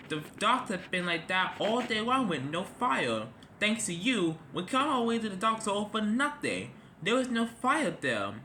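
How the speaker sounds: indignant, pitch pushed up; cross. A narrator's reading. Rescue helicopter scolding Charlie for the fake fire, cross. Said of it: "They were very cross".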